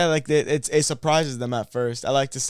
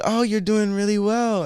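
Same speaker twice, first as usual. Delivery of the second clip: high encouraging voice; 10 semitones higher, 4 dB louder than usual. Retold or imitated. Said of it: "she's like".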